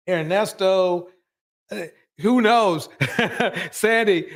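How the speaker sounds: giggling through the names; laughing. Real, not acted. Host laughing at her joke about Sandy.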